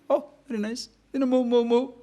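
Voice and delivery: impatient wife. Acted. Impatient wife urging husband on.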